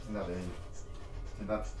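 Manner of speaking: low, soft sit-down; gentle coaxing. Real, not acted. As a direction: ease her down gently. Soothing a frightened woman.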